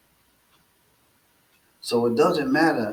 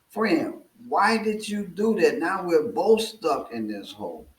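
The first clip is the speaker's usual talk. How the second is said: much higher, pleading and bewildered; stunned disbelief; 7 semitones higher than usual. Retold or imitated. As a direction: ask it like you can't believe he jumped in. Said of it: "is stunned".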